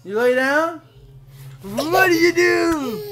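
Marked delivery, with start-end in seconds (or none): 1.6-3.1 high, drawn-out silly question; playful baby talk. Pretend. Dad playing with his toddler on the bed, playful baby talk.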